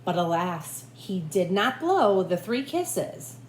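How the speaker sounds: ordinary delivery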